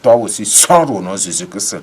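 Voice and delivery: loud preacher calling Lazarus out; forceful, booming summons in prayer; commanding shout. Acted. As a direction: thunder it as a command to rise. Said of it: "comme il a dit".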